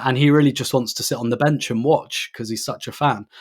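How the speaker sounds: usual speaking voice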